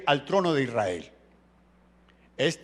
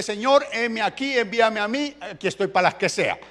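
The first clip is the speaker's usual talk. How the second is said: earnest prayer; 6 semitones higher, 3 dB louder than usual. Acted. Willing servant's prayer voiced.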